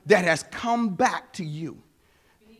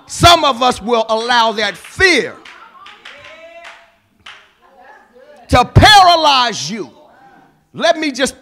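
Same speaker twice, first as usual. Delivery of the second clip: louder, higher rising sermon voice; preaching; 5 semitones higher, 6 dB louder than usual. Acted. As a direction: drive the point home to the church. Preaching to the congregation.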